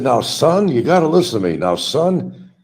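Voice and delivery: high, loud blustering cartoon rooster; booming Southern rooster bluster; Foghorn Leghorn. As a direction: lecture the boy like a pompous cartoon rooster. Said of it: "that mean old chicken, a rooster".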